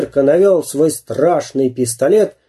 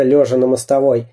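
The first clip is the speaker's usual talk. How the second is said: plain reading voice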